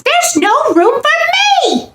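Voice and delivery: high, indignant squeak; squeaky mouse voice. A narrator's reading. Mouse complaining about his home, indignant squeak. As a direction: protest like a fed-up tiny mouse. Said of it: "squeaked Mortimer".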